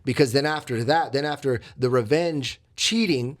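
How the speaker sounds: usual speaking voice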